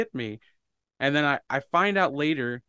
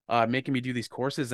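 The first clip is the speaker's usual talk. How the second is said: ordinary talk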